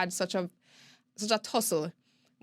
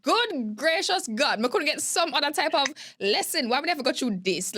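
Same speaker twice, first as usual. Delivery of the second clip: louder, higher cry to the heavens, slowing on Good gracious God; exasperated outcry; 7 semitones higher, 4 dB louder than usual. Retold or imitated. Looking back on hard lessons, a louder, higher exasperated outcry. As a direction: throw up your hands and exclaim, louder and higher. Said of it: "you're just like".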